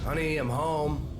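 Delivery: calling out. Acted. Calling out cheerfully entering home.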